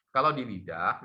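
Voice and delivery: ordinary talk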